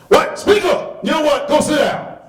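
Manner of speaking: very high, loud shout; imitating the sergeant shouting the mother down. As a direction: yell her down.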